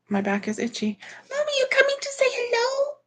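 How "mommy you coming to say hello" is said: very high, quick pet-coaxing voice; pet talk. Real, not acted. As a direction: coax a pet in a high little voice.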